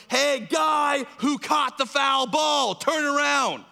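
high, loud, stretched-out call; slow shout. Retold or imitated. Tim calling the fan to turn around, loud slow shout. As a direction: yell it across the rows.

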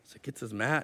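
usual speaking voice

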